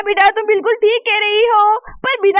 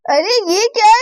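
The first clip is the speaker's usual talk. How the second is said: ordinary talk, nothing marked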